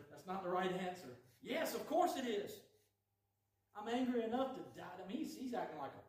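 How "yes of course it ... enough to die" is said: defiant sulk. Acted. Jonah answering God defiantly.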